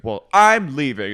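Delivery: loud, slow mock announcement; huffy exit. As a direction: storm off saying it. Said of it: "And then Miranda goes".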